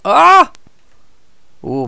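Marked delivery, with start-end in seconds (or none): 0.0-0.5 sudden high, loud yelp of alarm; scared horror game streamer. Real, not acted. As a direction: jump and yelp in fright. Near collision in the game.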